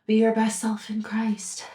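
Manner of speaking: slow, breathy whispered parody; mock whisper. Creator mocking the author's message, slow whisper. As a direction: whisper it like a sarcastic secret.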